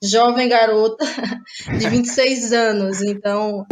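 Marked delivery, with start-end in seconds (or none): 1.1-1.7 soft breathy laugh. Real, not acted. Guest laughs at own words.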